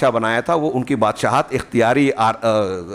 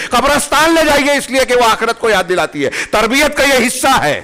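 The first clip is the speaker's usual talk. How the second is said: high, urgent exhortation, building in pitch; impassioned preaching; 8 semitones higher than usual. Acted. Preacher urging parents to instil fear of the Hereafter, impassioned. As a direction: urge them hard, voice climbing with each command.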